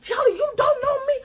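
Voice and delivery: high, loud mocking rant; mock yell. Narrator to the audience, mimicking the girl's high screeching rant. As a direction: screech her threats like a cartoon bully. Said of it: "All in my face, clapping, yelling".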